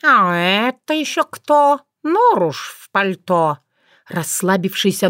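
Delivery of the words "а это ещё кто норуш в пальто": drawling, amused tomcat; slow, lazy and amused; lazy cat voice. A narrator's reading. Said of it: "кто-то зашевелился и уточнил".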